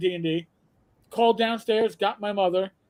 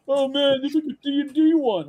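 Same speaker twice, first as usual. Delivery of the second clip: imitated friends; 5 semitones higher, 4 dB louder than usual. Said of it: "all my D and D friends were like".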